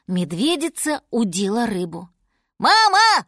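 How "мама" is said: put-on high, loud child's shout to mother; excited high-pitched call. A narrator's reading. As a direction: cub yelling for mama. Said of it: "крикнул ей медвежонок".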